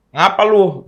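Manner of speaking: put-on high, loud Indian accent. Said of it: "Gitu kalau logat orang India ya".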